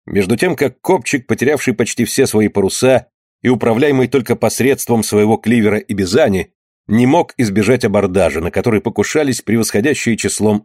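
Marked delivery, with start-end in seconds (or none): none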